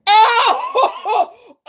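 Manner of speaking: high shrieking real laugh; helpless laughing fit. Real, not acted. Streamer cracking up at a comment, shrieking laughter. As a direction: lose it completely, shrieking.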